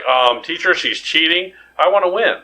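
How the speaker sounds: put-on a child telling on a classmate to the teacher; tattling kid. Tattling to teacher in spelling bee.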